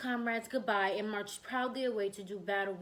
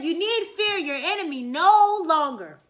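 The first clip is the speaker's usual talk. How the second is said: louder, proud and regal; proud loud declaration; 5 semitones higher, 9 dB louder than usual. Shown as a demonstration. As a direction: announce victory like a king. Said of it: "He said".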